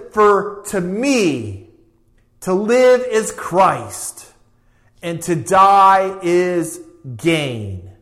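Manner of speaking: each phrase slow, spaced out and weighty; slow solemn quote. Acted. Preacher quoting a famous verse to the church, slow and solemn. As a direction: give every phrase its own slow beat.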